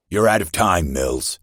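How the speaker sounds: captain's harsh ultimatum. A narrator's reading. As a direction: cut him off with a hard ultimatum. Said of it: "Captain Dorian's voice was harsh".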